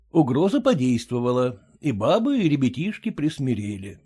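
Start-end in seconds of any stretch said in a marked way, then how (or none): none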